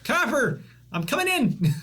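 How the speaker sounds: high playful call ahead; yelling. Acted. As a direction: shout a warning before entering. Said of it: "I also yell".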